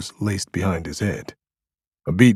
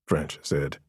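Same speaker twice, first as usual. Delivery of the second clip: usual speaking voice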